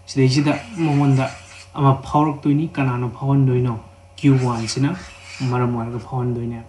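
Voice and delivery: normal talking voice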